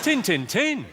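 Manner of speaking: high-pitched Yorkshire catchphrase; Yorkshire phrase in three punched beats; Yorkshire accent. Shown as a demonstration. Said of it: "My favourite Yorkshire phrase".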